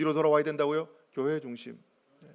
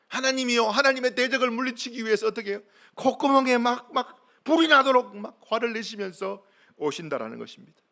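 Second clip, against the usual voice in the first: raised, high-pitched preacher's voice; impassioned sermon delivery; fervent preaching; 7 semitones higher, 4 dB louder than usual. Real, not acted. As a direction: press the point home with heat.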